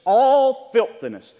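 "all" drawn out loud and high before "filthiness"; emphatic. Real, not acted. Preacher underlining the verse's word for sin, emphatic. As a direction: hit "all" hard and stretch it.

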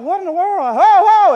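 loud and high, startled yell; alarmed shout. Retold or imitated. Pastor yelling as the stranger walks toward him, startled shout. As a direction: bark him to a stop.

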